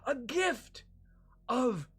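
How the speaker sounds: booming villain shout. Acted. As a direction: shout out the summons like a grand villain. Triclops offers drink to heroes.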